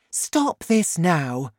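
slow, insistent order; Missus Cranky, firm. A narrator's reading. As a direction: put your foot down as the mother. Narrator voices Missus Cranky.

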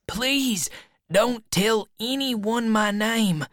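high-pitched earnest plea; pleading boy. A narrator's reading. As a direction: beg them to keep it secret. Frightened Huck pleads for secrecy.

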